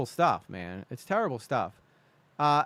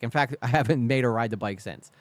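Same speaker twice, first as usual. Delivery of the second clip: ordinary talk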